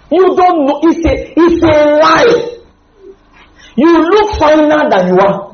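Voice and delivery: high, loud, near-tearful cry; preacher crying out against lies; impassioned cry. Real, not acted. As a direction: plead it out, voice breaking. Preacher's emotional outburst on lies.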